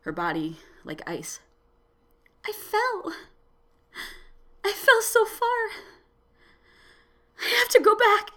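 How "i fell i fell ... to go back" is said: high, tearful, fading woman's voice; a frail woman near tears; shrinking smaller and smaller. A narrator's reading. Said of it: "Her voice got smaller".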